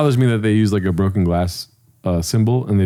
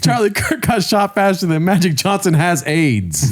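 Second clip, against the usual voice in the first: slow, high-pitched parody joke; mock host riff; 6 semitones higher than usual. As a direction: draw out the simile, pitched up. Mimicking the host's overblown similes.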